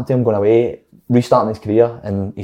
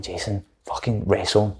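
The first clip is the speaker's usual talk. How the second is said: much softer, hushed retold egging-on; conspiratorial urging; 3 semitones lower, 11 dB quieter than usual. Player egging on teammate at lunch to wrestle, conspiratorial undertone. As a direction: say it low, as if just to a mate.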